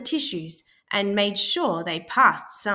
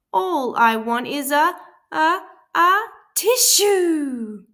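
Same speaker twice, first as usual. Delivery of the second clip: building to a sneeze; 8 semitones higher, 8 dB louder than usual. A narrator's reading. Sick wolf tries to ask for a tissue before sneezing. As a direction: stretch the a's as the sneeze builds. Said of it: "sniffled Big Bad".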